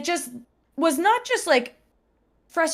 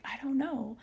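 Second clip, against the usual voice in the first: retold whisper; 12 dB quieter than usual. Friend answers quietly mid-class.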